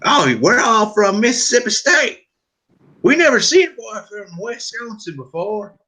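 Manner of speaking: Southern good ol' boy impression; Southern accent. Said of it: "just listening to 'em".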